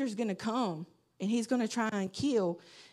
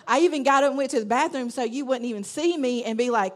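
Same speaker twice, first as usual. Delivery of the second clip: ordinary delivery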